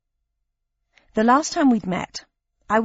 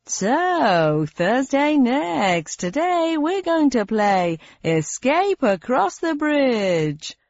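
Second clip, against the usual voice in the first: slow, higher showy announcer; game show host voice; 5 semitones higher, much slower than usual. Acted. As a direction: introduce the round with TV flair.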